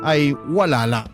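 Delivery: usual speaking voice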